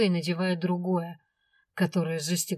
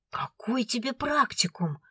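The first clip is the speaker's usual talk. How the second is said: indignant dragon husband; 5 semitones higher than usual. A narrator's reading. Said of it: "возмущался Свендл".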